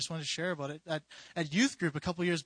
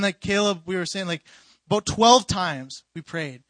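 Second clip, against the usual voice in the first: ordinary delivery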